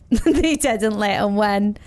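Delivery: ordinary delivery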